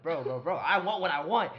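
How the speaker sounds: loud, rising drunk protest, retold; stubborn insistence. Drunk guy to friend over food order, loud insistence.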